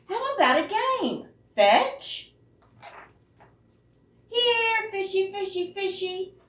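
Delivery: put-on high coaxing child voice calling the fish; coaxing boy. Boy coaxing the whale out of his pool, high sing-song. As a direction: lure it out sweetly like calling a pet.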